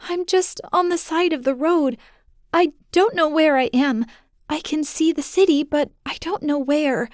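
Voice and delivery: put-on high little girl's voice, close to tears; tearful child. Daughter tells her father where she is, tearful. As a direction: push the words out while crying. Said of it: "she cried".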